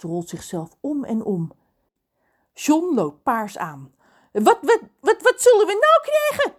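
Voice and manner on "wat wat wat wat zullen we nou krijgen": very high, loud spluttering rage; furious stutter. A narrator's reading. John explodes at Lorelei's refusal, stuttering furiously. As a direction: splutter with fury, tripping on the first word. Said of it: "stottert hij woedend".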